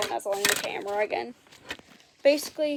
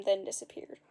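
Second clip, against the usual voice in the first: usual speaking voice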